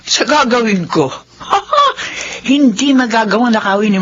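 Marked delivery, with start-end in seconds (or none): 0.0-2.5 tumatawang kontrabida, mataas ang boses; masamang tawa. Acted. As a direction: tawang mapanlinlang ng kontrabida. Villain plotting, evil laugh. (kontrabidang tumatawa habang nagbabalak)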